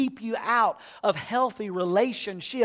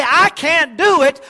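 raised, louder declaration I can't do it; louder declaration of faith; declaring aloud; 5 semitones higher, 6 dB louder than usual. Shown as a demonstration. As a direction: call it out to the congregation.

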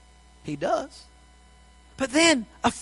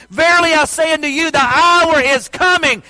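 loud, higher, slow preaching cry; full-force preaching; 7 semitones higher, 8 dB louder than usual. Acted. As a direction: thunder the scripture over the congregation.

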